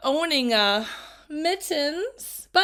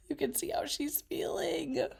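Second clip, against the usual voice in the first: whisper; 4 semitones higher, 7 dB quieter than usual. Real, not acted. Reading teacher whispering a comment to the class. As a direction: whisper it like a shared secret. Whispered aside to listening children.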